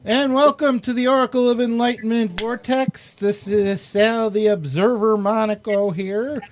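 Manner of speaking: upbeat show-opening announcer; show host intro. Acted. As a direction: welcome the audience like a showman. Host opens the live show.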